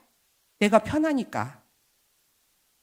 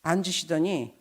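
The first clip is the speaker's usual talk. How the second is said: ordinary talk, nothing marked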